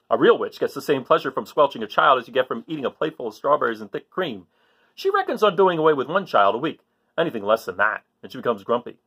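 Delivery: put-on lively read-aloud to children; story reading. A narrator's reading. Teacher reading to kids, lively storytelling voice.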